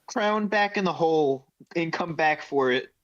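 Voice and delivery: ordinary delivery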